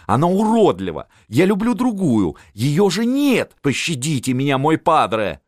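high-pitched distraught protest; pleading cry. A narrator's reading. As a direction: plead at the top of your range. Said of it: "закричал сын". (высокий умоляющий вскрик)

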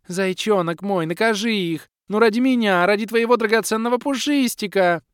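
put-on high, whiny pleading girl voice; капризная красотка. A narrator's reading. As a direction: beg your sweetheart, pouting and loud. Капризная красотка упрашивает милого. (капризно, высоким голосом)

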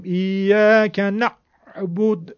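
"نعبد" with a harsh, forced ayn; too-hard ayn. Shown as a demonstration. Teacher showing students the overly harsh ayn. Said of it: "که این هم خیلی سخته".

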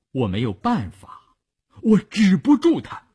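rising, exasperated plea; helpless protest. A narrator's reading. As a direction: protest to a superior, climbing high at the end. Doctor protests helplessly to officer. (无奈地争辩，越说越高)

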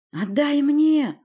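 loud, high, outraged shout; yell, stretching the final demand; frantic demanding bellow. Acted. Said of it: "снова заорал Порти".

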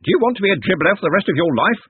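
put-on pompous old servant scolding; scolding candle knave. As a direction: berate the dribbler. Smeems scolds his apprentice.

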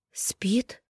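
rasped, breathless one-word question; a voiceless rising whisper; hoarse whisper. A narrator's reading. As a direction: wheeze it out in disbelief. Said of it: "просипела Даша".

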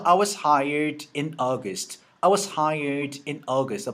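I was hired in August, slow and clear; slow model reading. Shown as a demonstration. Teacher reading the full sentence slowly as a model. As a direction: read it slowly for them to repeat. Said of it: "자, 그래서".

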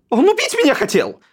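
terrified brother shrieking at the witnesses; cry breaking into falsetto. A narrator's reading. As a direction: voice cracks up into falsetto in panic. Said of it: "срываясь на фальцет, вскрикнул".

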